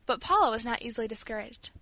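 ordinary delivery, nothing marked

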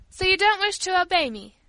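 high, loud challenge; stern, affronted father; cold severity. A narrator's reading. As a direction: question the child severely. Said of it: "fixing Paula with a cold and severe eye".

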